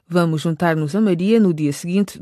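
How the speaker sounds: ordinary delivery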